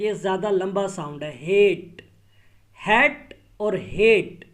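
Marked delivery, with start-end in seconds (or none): 1.4-2.0 हेट with a long, drawn-out a; long vowel hate. Shown as a demonstration. Teacher showing the long vowel, loudly. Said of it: "ये long vowel का sound है".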